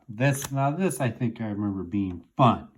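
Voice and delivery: ordinary talk, nothing marked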